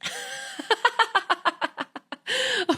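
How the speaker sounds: a long high giggle after the chant; delighted laugh. Real, not acted. Laughing to the viewers after the light language chant, delighted giggle. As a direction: let out a bright breathy laugh.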